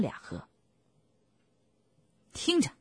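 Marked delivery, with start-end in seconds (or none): 2.3-2.7 high-pitched angry command. A narrator's reading. Bean commanding his two partners to listen, angry. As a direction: demand attention, indignant. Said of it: "他气愤地说".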